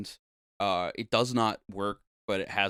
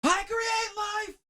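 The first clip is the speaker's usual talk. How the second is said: much higher, slow, grandiose shouted villain prince; Eddie Redmayne impression. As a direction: declaim it like a deranged galactic prince, shouting up high then easing off. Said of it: "I'm gonna do another Eddie Redmayne impression".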